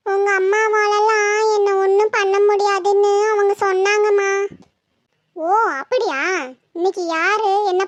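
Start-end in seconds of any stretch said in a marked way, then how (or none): 0.1-4.3 put-on level, drawn-out little-girl whine; whining child telling tales; tearful child. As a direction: report to mum in a weepy child voice. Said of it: "அழுதுகிட்டு இருக்க".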